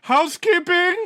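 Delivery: loud, high, comic shout of Housekeeping. Acted. Player shouts Housekeeping at the door, a loud comic call. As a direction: holler it through the door like hotel staff.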